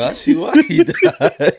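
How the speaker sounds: high, drawn-out words through laughter; slow, high-pitched laughing delivery; laughing mock mourner. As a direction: crack up while delivering the joke.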